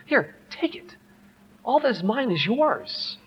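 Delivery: high, soft offering; giving in generously. Acted. Father handing his shoe sponge to son, high and soft. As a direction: offer it up in a lifted, softened voice.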